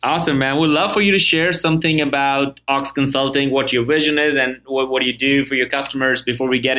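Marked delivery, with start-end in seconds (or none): none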